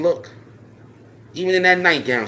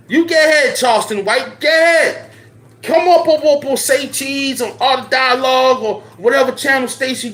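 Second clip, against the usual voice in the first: much higher and louder, yelling the dare; furious shout; 11 semitones higher, 5 dB louder than usual. Real, not acted. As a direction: shout him down, pitched way up. Daring rival to come on air.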